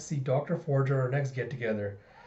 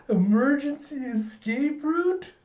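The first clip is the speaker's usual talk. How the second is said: put-on very high, slow, awestruck child voice; Anya; 12 semitones higher, 3 dB louder, slower than usual. Child discovers papa's secret exit, awestruck. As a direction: marvel slowly in a squeaky kid voice. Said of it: "And Anya's thinking".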